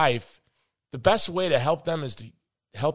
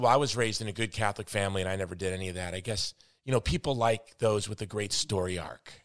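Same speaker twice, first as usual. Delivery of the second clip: snarky; 5 semitones lower, 6 dB quieter than usual. Retold or imitated. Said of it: "he was real snarky".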